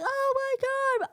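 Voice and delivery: very high, drawn-out wail; hammed-up sobbing voice; mock crying. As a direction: bawl it in a squeaky slow whine. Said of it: "She starts crying like".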